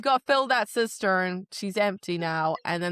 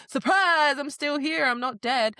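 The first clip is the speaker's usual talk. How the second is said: high, loud mock "surprise"; spring it on her with glee; 7 semitones higher, 4 dB louder than usual. King reappearing to taunt Nesta, mocking cheer.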